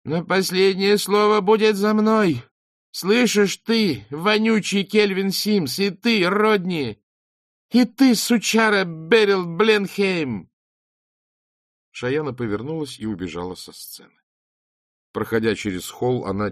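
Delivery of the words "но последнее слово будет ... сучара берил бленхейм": high, loud, slow raging threats; shrieking with hatred at each judge; furious screaming. Acted. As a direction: scream the last word at them. She screams curses at the judges.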